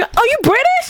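high, squealed North American accent impression; hammed-up North American astonishment; mock North American accent. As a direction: imitate an amazed North American, voice shooting up. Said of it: "weird British American accent".

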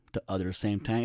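softly questioning the wording under the breath; quiet doubtful repeat. Shown as a demonstration. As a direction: mutter it back to yourself, unsure. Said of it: "is that a thing?".

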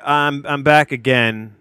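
normal talking voice

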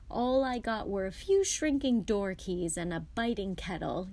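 ordinary delivery

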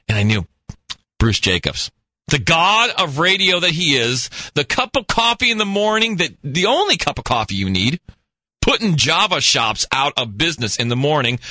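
ordinary talk

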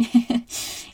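soft unvoiced chuckle; a quiet airy laugh at herself; little breathless giggle; breathy laugh. Real, not acted. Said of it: "I'm laughing".

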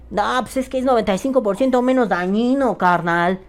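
high-pitched mock know-it-all pal; smoke-talk buddy. Vaper to a pal in everyday small talk, mock know-it-all. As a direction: push it up high, parodying casual chat. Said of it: "en la charla cotidiana, que de pronto sea un".

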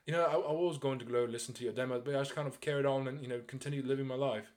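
a light British brush-off; Russell Brand impression. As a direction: brush him off casually in an English accent.

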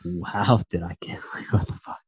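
unvoiced stunned whisper. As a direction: whisper it in disbelief. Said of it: "Dele Alli's probably like".

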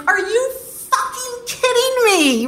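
high, incredulous cry of disbelief; like the floor just dropped out from under her; stunned disbelief. Retold or imitated. Learning husband still married.

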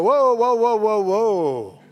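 loud, high "whoa" that stretches out slowly; retold protest. Family halting the new bride at the table, loud slow whoa. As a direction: stop her mid-prayer with a drawn-out cry. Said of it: "And they all go".

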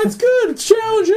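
high-pitched mock-casual reply; cheery small talk. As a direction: act out chirpy hellos over the din. Cheery retold small talk amid chaos.

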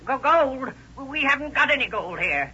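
put-on high, jumpy surprise from the old man; startled old man. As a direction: caught off guard, voice jumps up. Old man startled about hidden gold.

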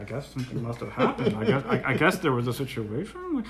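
soft, low imitation of onlookers; hushed murmuring. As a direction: murmur like embarrassed coworkers. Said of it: "hushed down a little bit".

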